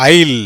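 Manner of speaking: ஐல் said like "I'll"; correct pronunciation. Shown as a demonstration. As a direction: say ஐல் with the S silent. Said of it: "எப்படி வருது?".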